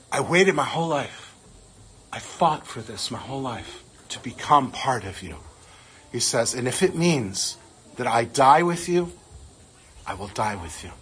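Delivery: low, soft, choked-up voice; holding back tears. Real, not acted. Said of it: "so I'm gonna try not to cry".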